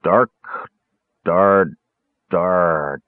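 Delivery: slow, broken-up sounding out; halting mumble. A narrator's reading. Caramon squinting at a map, lightly mumbling. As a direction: stumble through the name, word by word. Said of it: "he mumbled".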